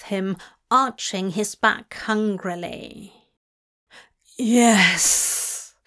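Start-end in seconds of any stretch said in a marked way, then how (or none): 4.4-5.7 long hissed exhale on the word; breathless hiss. A narrator's reading. Demon answering his lover in a breathless hiss. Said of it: "he hissed breathlessly".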